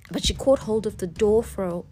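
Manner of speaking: ordinary delivery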